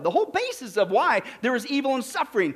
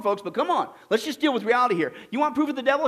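ordinary delivery

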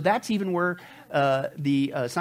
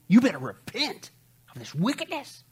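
stern warning; 6 semitones higher than usual. Acted. Preacher voicing Peter's rebuke.

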